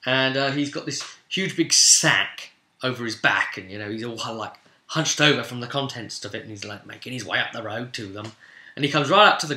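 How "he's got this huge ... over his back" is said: ordinary talk, nothing marked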